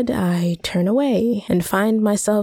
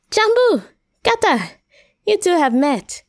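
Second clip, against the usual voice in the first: bright hostess greeting, much higher; cheery; 11 semitones higher than usual. Acted. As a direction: sing out the names like a delighted host. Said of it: "says a cheery Belinda".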